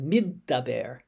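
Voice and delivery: middaber with the d held double across the syllables; doubled d. Shown as a demonstration. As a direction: split middaber as mid-daber, two d sounds. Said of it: "the way it should be pronounced is middaber".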